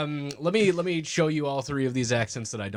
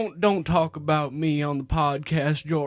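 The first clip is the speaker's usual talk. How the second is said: slow, higher pleading brother; Jebby; 4 semitones higher, 3 dB louder, slower than usual. Acted. Friend playing the brother begging George, pleading voice. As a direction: draw out the embarrassed plea. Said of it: "and he said".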